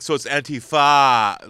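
"Antifa" with a long, drawn-out "fah"; mocked pronunciation. Bar-goer mocking a guy's Antifa, dragging out the "fah". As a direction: lean hard and slow on the end of "Antifa". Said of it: "why do you gotta say it like that?".

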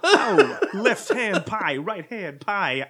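high, loud laughing Walken yelps; Walken eating pies. Pretend. Playing Walken double-fisting pies for his partner, high and laughing. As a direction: whoop it up with a pie in each hand.

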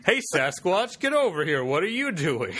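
calling out. Retold or imitated. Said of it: "Sasquatch call".

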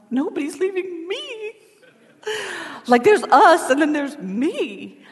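put-on dramatic teen protest. Teen daughter protesting to her mom.

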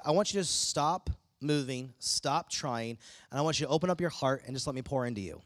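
soft, low, tender invitation; God's gentle voice. Retold or imitated. God telling believers to stop striving, soft and tender. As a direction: coax them gently to stop and receive. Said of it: "God's just like".

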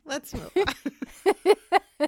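high, loud and laughing. Real, not acted. Co-host closes the teasing dispute with her friend, laughing. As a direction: dissolve into laughter, dragging the words out.

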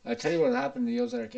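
ordinary talk, nothing marked

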